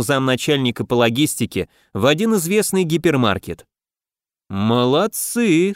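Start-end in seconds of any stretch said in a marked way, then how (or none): 4.5-5.7 slow, respectful drawl; drawn out. Acted. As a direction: stretch the word out with respect. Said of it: "с уважением протянул Игнат".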